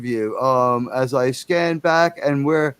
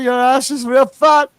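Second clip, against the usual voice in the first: put-on higher, loud Cuban accent; hammed-up Cuban husband scolding; 8 semitones higher, 5 dB louder than usual. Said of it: "I'm a crazy Cuban".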